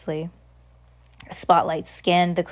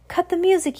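shouting; 11 semitones higher than usual. Acted. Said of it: "he shouted".

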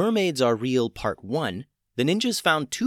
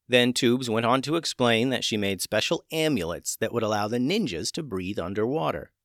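ordinary delivery

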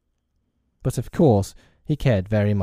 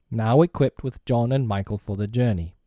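usual speaking voice

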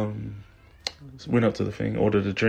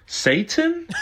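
high, uncertain call-out; barista calling name; 3 dB louder than usual. Retold or imitated. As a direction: imitate a puzzled barista calling an order. Retold barista calls out name.

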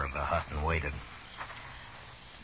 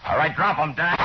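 loud, higher barked order; shouted command; 10 semitones higher, 6 dB louder than usual. Acted. Marshal shouts at the outlaw charging the corner with guns drawn. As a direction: stop him cold with your voice.

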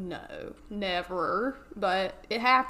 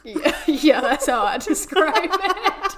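real laughter, louder, higher; laughing; 6 semitones higher, 8 dB louder, slower than usual. Real, not acted. Cohost laughing at being called the emotional one, giggling. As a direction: laugh through the agreement.

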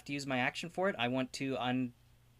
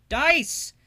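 very high, loud cry to the dice; excited plea; 13 dB louder than usual. Real, not acted. Player cries out to the dice before rolling, high and loud. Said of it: "pleads with the dice".

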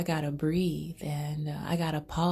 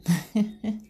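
high laugh; 6 semitones higher, 5 dB louder than usual. Real, not acted. Laughs at her joke.

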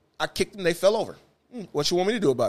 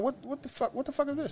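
high, hushed what-the-fuck; baffled and half under the breath; shocked disbelief; 8 semitones higher, 12 dB quieter than usual. Retold or imitated. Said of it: "I'm going up and down like".

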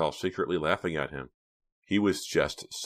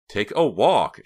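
higher, louder swooping dismissal; cruel; 5 semitones higher, 8 dB louder, slower than usual. A narrator's reading. Reva repeats her order to Hank, cruelly. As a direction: hit WALK hard and cruelly. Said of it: "she repeated cruelly".

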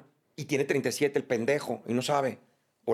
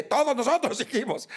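high, emphatic mock retort; indignado fingido; 10 semitones higher, 4 dB louder than usual. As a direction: act out an indignant crowd answering back. Acted argument over limits of love. (réplica indignada y aguda, actuada)

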